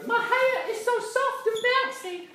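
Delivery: put-on high, slow, airy valley-girl coo; blonde American girl. Shown as a demonstration. Leader demos the blonde wig line, high and airy. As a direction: toss your wig and coo about your hair. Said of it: "beautiful, blonde American wig".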